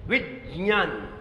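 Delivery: विज्ञान said slowly as "vit-jnyaan"; corrected pronunciation. Shown as a demonstration. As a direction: slowly say विज्ञान with a stop, then j. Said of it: "सही ये है". (विज्ञान को धीरे से "विज्-ज्यान" जैसा बोलते हुए)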